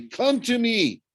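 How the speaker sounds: high, drawn-out call; desperate plea. A narrator's reading. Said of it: "I thought desperately".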